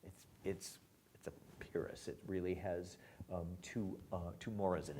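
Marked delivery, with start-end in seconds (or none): none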